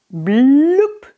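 put-on a croaky, slow old fish sound. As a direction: croak the blub like an ancient fish. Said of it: "machte eine krächzende Stimme".

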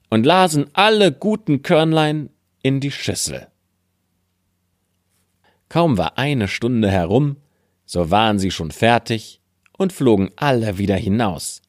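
ordinary delivery

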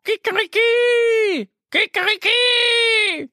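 very high, slow drawn-out crow; stretched rooster call at the top of the voice. Acted. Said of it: "brüllt der Hahn".

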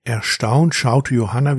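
ordinary delivery, nothing marked

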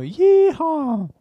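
high whooping yeehaw; cowboy yell. Pretend. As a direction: whoop like a cowboy swinging a lasso. Lasso schwingen, Cowboy-Ruf. (Cowboy-Jubelruf)

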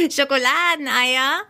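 high, cackling laugh into incredulous question; high-pitched amused disbelief; laughing hard. A narrator's reading. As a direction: burst out laughing, then echo it in disbelief. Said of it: "Michi musste lachen".

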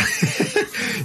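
a sudden squealing laugh, high up; high burst of laughter. Real, not acted. Cracking up at his co-host's joke about whistling through tooth gaps, high laughter.

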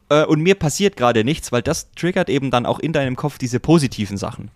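ordinary delivery

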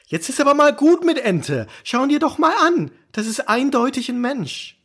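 high, exasperated, fast scolding. Acted. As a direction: pitch up, fed up with the nonsense. Fed up with the duck claims. (genervt und hoch, schimpfend)

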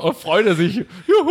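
mock cheer. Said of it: "Und dann so".

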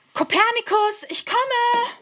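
high, bright call to a friend. Acted. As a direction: call out while running over. Mira calling to Kopernikus.

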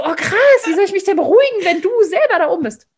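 high, loud half-laughing alarm; worked-up panic. Retold or imitated. Child caught up in grandmother's hysteria, high and loud. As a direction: get swept up in her panic. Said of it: "wie man selber mit hochgeht".

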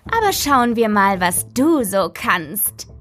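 slow, menacing demon voice drawn out; a lightly voiced lurking evil; sinister creature. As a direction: speak as the darkness inside her. The creature taunts.

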